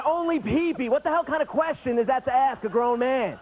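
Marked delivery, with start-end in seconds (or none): 0.0-0.9 pitched-up echo of the clerk's line; mock clerk voice, raised pitch; incredulous repeat. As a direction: repeat it in disbelief, higher. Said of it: "That really happened".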